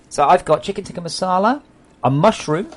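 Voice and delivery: loud, high menu announcement. Real, not acted. Streamer announcing dinner to viewers, loud and high. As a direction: call out each dish loudly, ending on a rise.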